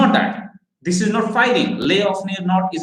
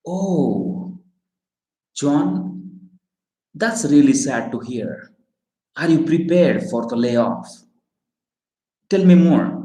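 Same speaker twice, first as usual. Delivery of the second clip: sympathetic sad; 2 semitones lower than usual. Shown as a demonstration. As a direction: lean in and let the voice contract with sadness. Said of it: "If that is a sad thing".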